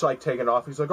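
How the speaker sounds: ordinary talk, nothing marked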